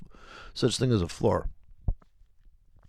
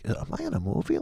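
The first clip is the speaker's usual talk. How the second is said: mock disbelief; 14 semitones higher, 6 dB quieter, faster than usual. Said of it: "you're like".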